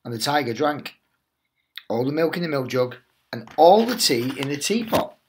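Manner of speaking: lively storybook read-aloud voice; animated teacher reading The Tiger That Came to Tea; reading to children. Acted. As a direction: tell it like a bedtime picture book for little ones. Teacher reads picture book aloud.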